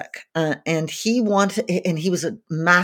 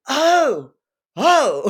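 imitated dawning realisation; high, drawn-out "oh", then a second; 8 semitones higher, 3 dB louder than usual. Singer imitating her hero finally catching on, surprised. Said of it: "And he's like".

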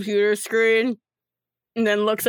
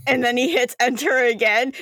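ordinary talk

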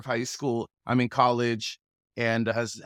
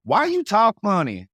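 Southern relatives teasing; 9 semitones higher, 7 dB louder than usual. Retold or imitated. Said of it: "they'd always be like".

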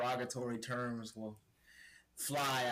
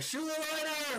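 high mock call of a job title. Trainees calling him to his shoe job, high mock shout. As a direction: sing out the title like a summons. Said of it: "they'd be like".

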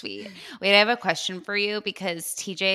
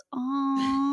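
put-on cute toddler aw; 7 semitones higher than usual. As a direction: coo "Aw." up high, sweetly. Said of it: "gives it a hug and goes".

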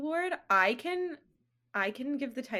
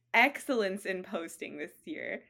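ordinary delivery

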